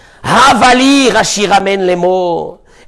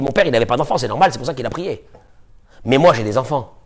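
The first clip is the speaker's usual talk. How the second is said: lower, softer, matter-of-fact paraphrase; calm paraphrase; 7 semitones lower, 5 dB quieter, faster than usual. Acted. The teacher restating Yaakov's answer to Rachel calmly: quiet paraphrase. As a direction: say it plainly and quietly, explaining. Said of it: "En d'autres mots, qu'est-ce qu'il lui dit?".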